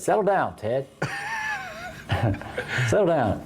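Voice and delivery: laughing. Pretend. Joking at Ted, laughing.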